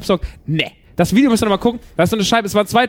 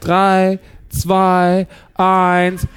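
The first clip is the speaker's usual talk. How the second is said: count-in; 5 semitones lower, much slower than usual. Real, not acted. Counts the audience in.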